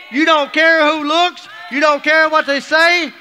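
very high, loud, slow, fervent preaching; full-force preaching. Acted. Telling the congregation about the joyful reunion, preaching at full force. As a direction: preach it with everything you've got.

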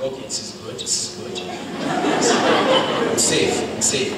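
soft, low relieved murmur with a small laugh; hushed relief. Retold or imitated. Preacher retelling his relief before God, hushed and laughing. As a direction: sigh out your relief under your breath.

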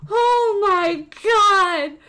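long drawn-out, amazed exclamation; awestruck. Real, not acted. Streamer seeing another rare summon, awestruck. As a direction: stretch each word slowly in disbelief.

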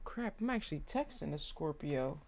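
usual speaking voice

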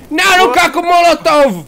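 high-pitched cry of alarm; game-play alarm. Pretend. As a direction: call out that you're under attack. Panicked report of attack in game.

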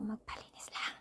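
a breathy confiding whisper, as if telling a secret; whispered aside. Real, not acted. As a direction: drop to a whisper for the punchline. Aside about just wanting a cleaning.

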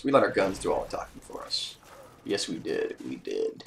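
low, soft dramatic aside; mock tough mutter. Shown as a demonstration. Bragging to viewers about the win, low dramatic aside. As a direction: drop it low like a movie tagline.

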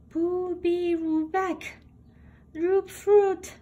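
ordinary delivery, nothing marked

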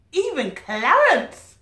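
even Clarence said high, loud and slow; emphatic reveal. A narrator's reading. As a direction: punch "even Clarence" with delight. Reader stresses surprising reveal.

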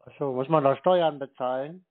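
usual speaking voice

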